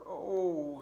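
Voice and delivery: high, loud mock moan; moaning pack rat. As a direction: groan as a sore little rat.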